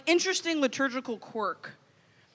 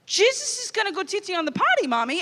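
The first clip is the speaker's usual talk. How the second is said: higher, mock toddler announcing it; a lightly voiced toddler, a bit louder; 5 semitones higher, faster than usual. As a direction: say it again for the whole church. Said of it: "a little bit louder".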